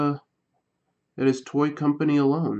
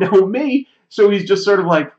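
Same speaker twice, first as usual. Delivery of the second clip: normal talking voice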